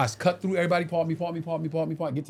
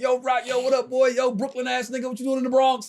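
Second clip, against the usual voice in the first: higher shouted calls from afar; calling across crowd; 6 semitones higher than usual. Retold or imitated. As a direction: holler over the noise at a friend.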